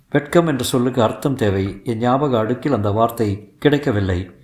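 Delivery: usual speaking voice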